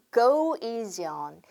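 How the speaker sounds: slow, careful "go easy on"; drawn-out teaching demo; slow model phrase. Shown as a demonstration. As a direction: lay out each word for the class to copy. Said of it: "한 번 더".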